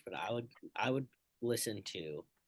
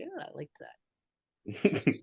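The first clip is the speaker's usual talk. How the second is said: slow, delighted drawl; drawn-out approval; 5 semitones higher, 6 dB louder, much slower than usual. Retold or imitated. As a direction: savor the words slowly. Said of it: "I might look at and be like".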